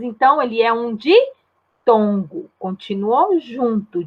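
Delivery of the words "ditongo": "ditongo" broken into di... tongo; drawn-out teacher's reveal of "ditongo"; split word. Shown as a demonstration. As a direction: hold di in "ditongo", pause, then finish. Splitting the term while teaching.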